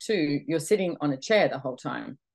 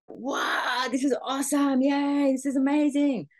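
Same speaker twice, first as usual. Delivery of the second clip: much higher, gushing mock delight; mock runner's joy; 10 semitones higher than usual. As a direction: play the overexcited runner for laughs. Imitating runner's early joy.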